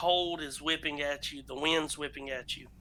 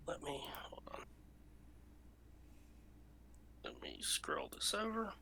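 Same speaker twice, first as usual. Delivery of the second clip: breathy whisper to himself while scrolling the map; whispered aside; 17 dB quieter than usual. Real, not acted. Game master whispering to himself over the map. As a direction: mutter it under your breath.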